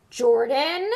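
a stern loud warning; growl. A narrator's reading. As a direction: warn him sharply. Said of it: "growled Professor McGonagall".

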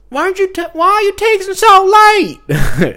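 very high, loud mock southern girl voice; southern girl imitation. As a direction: squeak it out like a small-town Missouri girl. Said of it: "some girl with, like, a southern accent".

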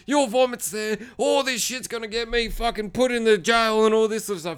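high, agitated mock rant; freaking out. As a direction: imitate a man panicking as he argues. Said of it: "he's freaking out".

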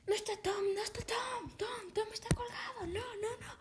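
high, breathless panicked whisper; frantic whisper. Acted. Camper begs the others to believe him, terrified whisper. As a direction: gasp it out in terror.

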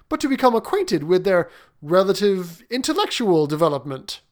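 baffled and complaining; perplexed prince. Acted.

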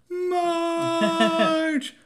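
long high wailing yell of a name; a hammed-up Brando bellow; anguished bellow. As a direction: howl it up to the window like Brando. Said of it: "reference to the play in the movie, the Stella".

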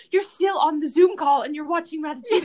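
high laughing mock complaint; whiny complaint. Girlfriend retelling scolding partner on a Zoom call. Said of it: "And I was like".

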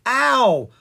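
sharp, high yell of pain; a loud ow from a hard landing; a pained yelp, loud and high. Acted. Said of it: "Violet yelled".

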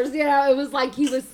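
normal talking voice

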